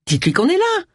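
high, impatient bark; barked order. A narrator's reading. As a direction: bark the order, pitch shooting up. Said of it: "aboya Agatha". (ordre aboyé, très aigu)

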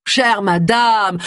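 high, very drawn-out appeal; placating address. Acted. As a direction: stretch it out to placate her. Cornered man placates his accuser.

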